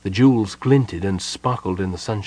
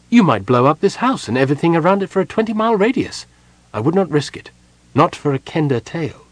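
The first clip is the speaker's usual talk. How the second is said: higher, louder warning, building fast; lightly voiced stern sorceress; 6 semitones higher, 5 dB louder than usual. A narrator's reading. Jenna warns Parlin off the trip, rising urgency. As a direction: pile up the dangers, a hint of alarm.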